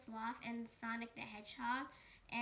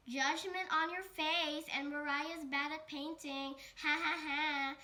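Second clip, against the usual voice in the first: louder, higher jeering with a fake laugh; mocking taunt; 4 semitones higher, 6 dB louder than usual. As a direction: rub it in her face. Sonic taunts First Girl.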